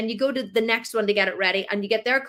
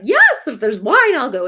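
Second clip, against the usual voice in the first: eager yes; 3 semitones higher, 9 dB louder than usual. Retold or imitated. Mum accepting the invite because of wine, eager. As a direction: jump at the wine. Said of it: "So I was like".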